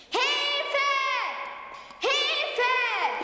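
distant cries for help. Acted. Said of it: "genau wie ihre Stimme angehört".

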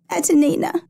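high-voiced girl, a touch of tearfulness; sobbed reply. A narrator's reading. Injured girl giving her rescuer her name, lightly acted sobbing. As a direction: answer as an upset child, pitched well up. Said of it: "the girl sobbed".

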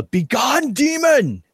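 high-pitched battle cry at a demon; in-character yell. Acted. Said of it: "just yell".

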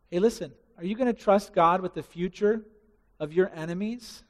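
usual speaking voice

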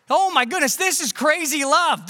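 high, loud, astonished gasp of wonder; amazed heavenly voices. Retold or imitated. Angels marvelling at the baby, high and amazed. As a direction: burst out in awe at the news.

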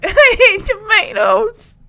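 high, drawn-out mock wail; mock crying. Bride lifting up the basket, mock crying. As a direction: whimper it loudly, pretend tearful. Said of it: "switches from crying".

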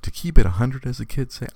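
usual speaking voice

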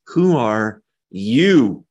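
slow, with a pause before a heavy YOU; stress on YOU. Shown as a demonstration. Preacher showing the emphatic Greek word, stress on YOU. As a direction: draw it out and land hard on "you". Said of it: "in Greek, it reads like this".

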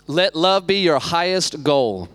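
slow, deliberate scripture read; emphatic verse reading. Acted. Pastor reads the key verse to the congregation. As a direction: weigh every word of the verse.

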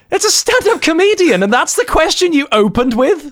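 high, mock-outraged disbelief; incredulous. Real, not acted. Mocking a dull radio interviewer, high and incredulous. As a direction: squeak out how absurd it is.

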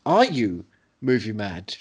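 normal talking voice

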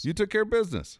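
high sarcastic parroting; mocking echo.

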